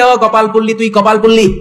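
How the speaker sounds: high, chanted scolding; imitating a husband's cry of reproach; retold angry yell. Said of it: "চিৎকার দিয়ে বলে".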